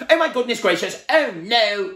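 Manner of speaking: high, loud panicked cry; frightened cry. A narrator's reading. Mister Jelly hearing crackling cornflakes as guns, high panicked cry. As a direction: yelp and dive for cover. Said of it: "cried Mister Jelly".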